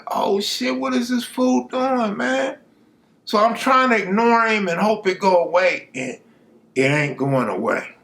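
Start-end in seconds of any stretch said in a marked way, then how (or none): 0.1-2.6 high, incredulous aside; exasperated disbelief. Retold or imitated. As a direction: raise the pitch in disbelief. Speaker's exasperated inner reaction.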